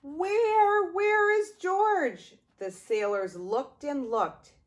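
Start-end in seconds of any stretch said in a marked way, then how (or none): 0.0-2.4 calling out. Acted. Sailors search for missing George.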